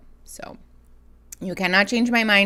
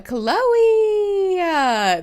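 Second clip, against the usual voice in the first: excited; 12 semitones higher, 8 dB louder than usual. Real, not acted. Greeting a chatter with excitement.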